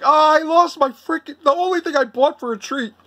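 high-pitched, retold frantic wail; going crazy. Retelling losing the Walker's cookies, mock frantic. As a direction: whine it high, hammed-up panic. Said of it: "I was going crazy".

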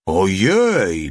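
drawn-out oh dear, falling at the end; repeated exclamation. A narrator's reading. Little one repeating his cry at the sight, amazed exclamation. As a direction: stretch out the startled cry. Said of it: "powtórzył mały".

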